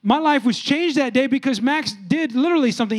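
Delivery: ordinary talk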